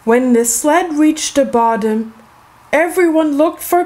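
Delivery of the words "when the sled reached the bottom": usual speaking voice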